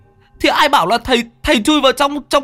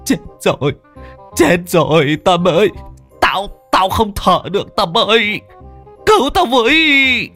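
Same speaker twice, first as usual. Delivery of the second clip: giọng cao dần, hoảng hốt kêu cứu; kêu cứu vì ngạt khói; hoảng loạn kêu cứu; 11 semitones higher than usual. Acted. Bị khói cay ngạt thở. (thằng Thắng ngạt khói kêu Tâm cứu)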